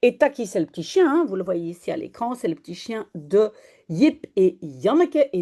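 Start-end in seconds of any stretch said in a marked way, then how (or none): none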